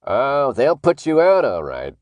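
slow, loud, drawn-out taunt; gleeful menace. A narrator's reading. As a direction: savour the threat with relish. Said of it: "looked as if he was smiling behind the mask".

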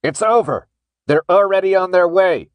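high, loud shout held on one tone; creepy mechanized shout. Acted. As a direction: shout it out like a machine. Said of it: "he shouted again in that creepy mechanized voice".

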